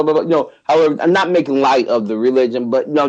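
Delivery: ordinary talk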